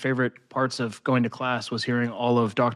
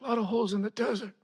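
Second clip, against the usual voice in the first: fast, higher, softer gangster quip; mock mobster aside; 11 semitones higher, 5 dB quieter, faster than usual. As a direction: toss off a sly mafia hint. Mobster joke continued.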